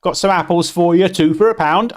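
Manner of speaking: loud, high Cockney market-trader patter; hammed-up Cockney street seller. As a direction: call out your wares in mock Cockney. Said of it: "like, a Cockney".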